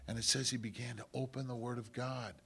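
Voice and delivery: ordinary talk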